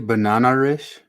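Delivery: BANANA-ISH with an R before ISH; intrusive R. Shown as a demonstration. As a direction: put an R into banana-ish, as if banana-rish. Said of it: "They say".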